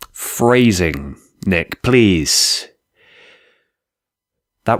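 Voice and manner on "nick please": normal talking voice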